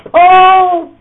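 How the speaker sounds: high, loud shout of "Pull!"; shouted command. Retold or imitated. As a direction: call the whole line to heave at once. Leading group tug of war.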